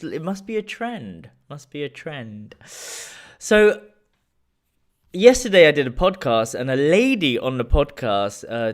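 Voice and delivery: ordinary talk, nothing marked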